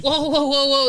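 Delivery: high, loud alarmed whoas. Retold or imitated. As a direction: break up a bar fight in alarm. Bartender breaking up a fight.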